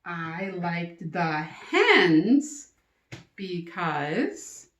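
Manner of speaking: slowly modelled, rising on because; teacher showing the hens option slowly; slow model sentence. Shown as a demonstration. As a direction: spell it out for kids. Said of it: "So you can either say".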